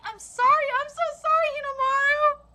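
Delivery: loud, high, pleading apology; plead, close to tears; tearful apology. Acted. Dying mother apologising to her son, acted tearful plea.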